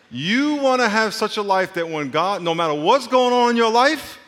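loud, high, stretched exhortation; preaching at full force. Real, not acted. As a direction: drive the point home to the congregation. Preacher driving home the point.

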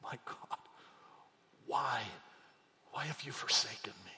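Jesus quoting the psalm, hushed; hushed anguish. Retold or imitated. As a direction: breathe the words of the cross. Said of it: "he quotes Psalm twenty-two".

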